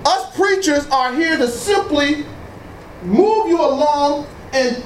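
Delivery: ordinary talk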